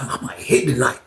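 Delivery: soft, low-key thought to himself; hushed private hope; quiet musing. Retold or imitated. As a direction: say it under your breath. Musing to himself, two takes.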